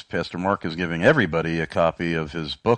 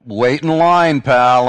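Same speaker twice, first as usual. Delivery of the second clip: high, loud comic retort; jokey shouted put-down; loud comic brush-off; 8 semitones higher, 10 dB louder, slower than usual. Acted. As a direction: cut him off, loud and punchy. Joke figure cuts off apology.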